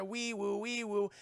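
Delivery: high sing-song alarm wail; imitated siren. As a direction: wail like a two-note siren.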